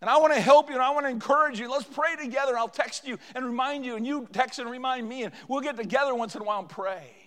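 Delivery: put-on older church member encouraging a young person; encouraging elder. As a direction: put your arm around them and encourage. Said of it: "an older person would ... say".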